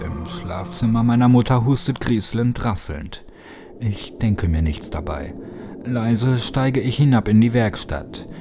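ordinary delivery, nothing marked

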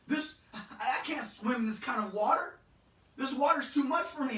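a drowning man losing nerve; frantic Peter. Acted. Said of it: "he sees the water and he says".

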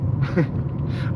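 soft breathy chuckle; quiet laugh at your own outburst; small, voiceless self-mocking laugh; sheepish chuckle. Real, not acted. Laughs after apologising for outburst.

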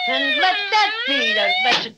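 very high triumphant yell; gloating shout. Acted. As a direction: crow it out over the fallen foe. Bear shoves policeman, gloating.

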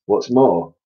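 plain reading voice

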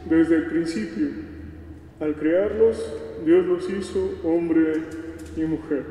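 normal talking voice

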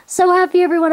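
overjoyed, voice lifted high; real happiness. Real, not acted. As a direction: gush with real delight. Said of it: "I'm so happy".

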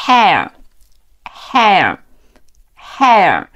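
"hair" slowly, drawn out, with pauses between; model pronunciation. Shown as a demonstration. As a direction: say "hair" slowly for learners to repeat. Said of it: "边看视频边跟读". (慢速领读hair，每遍停顿)

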